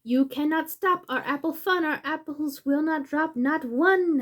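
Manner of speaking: storybook animal voice. Acted. Animals pushing past the baby bear, high and urgent. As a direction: push through in a rush.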